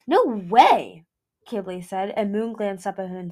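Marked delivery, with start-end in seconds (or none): 0.1-1.0 loud no. A narrator's reading. Boisterous refusal to go back.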